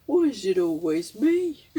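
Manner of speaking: slow, lightly voiced Brummie moan; slow half-hearted Birmingham whine; Brummie accent. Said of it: "he said it in a Brummy accent".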